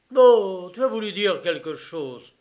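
loud, high opening burst; angry pressing. A narrator's reading. Father challenging his evasive son, angry pressing. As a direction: press him hard for the meaning.

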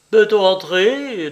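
high, loud, drawn-out call; calling from next room. A narrator's reading. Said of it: "demanda Ratmirov de l'autre pièce". (appel fort et traînant depuis la pièce voisine)

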